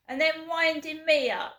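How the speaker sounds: rising angry outburst. Real, not acted. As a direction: spit it out, fed up and furious. Angry rant at mystery pranksters.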